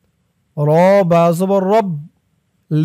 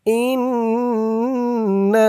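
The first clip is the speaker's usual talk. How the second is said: slow melodic tajweed chant; Quran recitation; 5 semitones higher, much slower than usual. Shown as a demonstration. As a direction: draw out every vowel in recitation. Recites word slowly.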